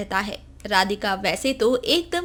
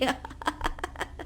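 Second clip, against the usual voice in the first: stifled giggle; 12 dB quieter than usual. Acted. Suppressed giggle after compliment.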